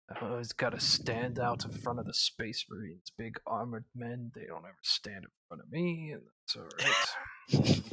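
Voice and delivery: quiet, sulky in-character grumble; mock sad mutter; mock self-pity.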